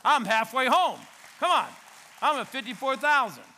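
high, loud triumphant call; excited shout. Real, not acted. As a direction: crow the number to the crowd. Triumphant tally to congregation.

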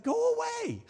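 very high, comic shooing; exaggerated high-pitched "off you go"; insensitive brush-off. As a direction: squeak out a blithe dismissal. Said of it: "like, insensitive".

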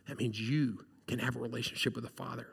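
normal talking voice

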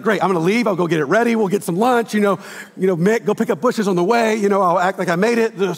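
high, loud, hurried hostess scrambling; mock flustered wife. Pastor imitating Steph's reaction to the surprise guest, flustered. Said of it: "Steph's gonna be like".

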